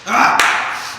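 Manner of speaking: sudden loud pained cry; pained yell at the heat. Real, not acted. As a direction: yell out as the spice hits. Biting into the hottest wing.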